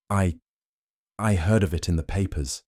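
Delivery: nervous stammer, halting start. A narrator's reading. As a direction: stumble over the first word. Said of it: "he stammered".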